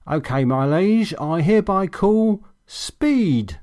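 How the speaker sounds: high, slow, grand mock bow of a line; slow pompous mockery; mocking flourish. A narrator's reading. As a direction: ham it up like a herald to a king. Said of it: "said Toby with a mocking flourish".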